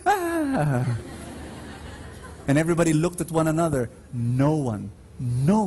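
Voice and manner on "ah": high startled "ah"; a sharp, high gasp of alarm; crowd's alarmed cry at the dare. Retold or imitated. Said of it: "And everyone who's cheering".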